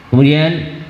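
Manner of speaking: usual speaking voice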